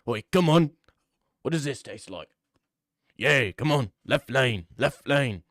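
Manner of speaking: lightly put-on British accent; British Left Lane. Left Lane asking a girl for a channel name, mock British. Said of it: "Left Lane, the type of guy".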